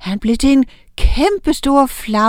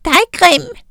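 put-on very high, loud baby voice; high loud baby tease; giggly baby witch; 9 semitones higher, 5 dB louder than usual. A narrator's reading. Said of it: "fnisede Oda".

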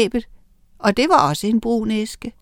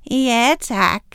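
fake smile; 2 semitones higher than usual. A narrator's reading. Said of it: "med et falsk smil".

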